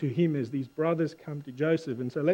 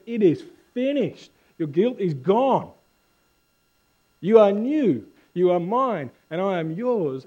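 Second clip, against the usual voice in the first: raised preaching voice, slow weighty phrases; emphatic declaration; 4 semitones higher, 5 dB louder than usual. Acted. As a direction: declare it as Jesus, each statement landing separately. Preacher voicing Jesus' declaration from cross.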